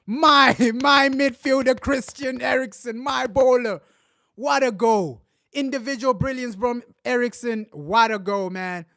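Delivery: loud, high, excited gushing; thrilled praise. Real, not acted. Fan raving about the goal scorer, loud and high. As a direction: keep riding the goal high.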